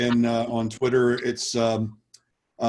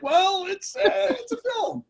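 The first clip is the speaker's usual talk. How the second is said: mock hesitant voice, much higher, searching for a kind word; imitating Darren; slower than usual. Actor jokes about the director's reaction to the film, mock hesitant high voice. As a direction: play the reluctant reviewer, stalling high and unsure. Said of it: "He's like".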